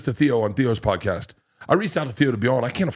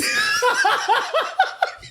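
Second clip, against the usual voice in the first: big high laugh. Real, not acted. Host cracks up at his own bus story, laughing. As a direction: burst of high-pitched laughter.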